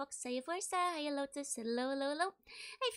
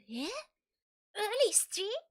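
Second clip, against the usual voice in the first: mock indignant chat; 7 semitones higher, 6 dB louder than usual. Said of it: "everybody's like".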